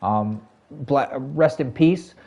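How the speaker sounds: ordinary talk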